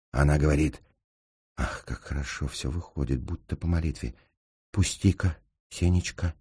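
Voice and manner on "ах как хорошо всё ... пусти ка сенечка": quiet aside. Acted. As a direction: say it softly, as if to yourself. Tender aside to Senya before acting. (тихо, вполголоса)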